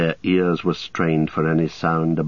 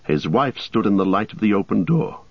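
normal talking voice

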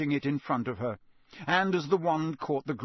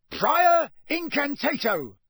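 raised, loud, slow incantation roar; roared spell; 14 semitones higher, 7 dB louder, slower than usual. A narrator's reading. Official casting on the wands, roaring. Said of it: "roared Mister Diggory".

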